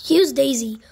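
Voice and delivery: usual speaking voice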